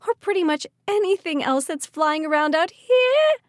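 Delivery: high, loud frightened wail. Acted. Callie wailing to Malachi while running through the storm. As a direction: wail it in panic. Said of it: "Callie wailed".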